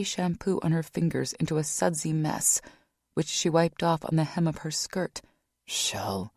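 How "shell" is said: low soft whisper; murmured comfort to his sister. A narrator's reading. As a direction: hush it gently. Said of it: "Frank whispered".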